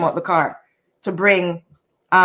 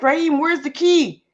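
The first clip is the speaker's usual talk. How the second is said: exasperated questioning; 6 semitones higher than usual. Retold or imitated. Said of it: "I'm like".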